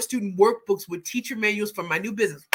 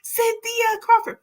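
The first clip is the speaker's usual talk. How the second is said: excited; 14 semitones higher, 4 dB louder, slower than usual. Real, not acted. Greeting a viewer on stream.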